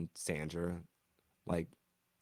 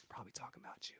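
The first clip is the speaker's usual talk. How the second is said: much softer, whispered warning; whispered aside; 21 dB quieter, much faster than usual. Acted. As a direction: lean in and breathe the hint. Ominous aside to Sandra.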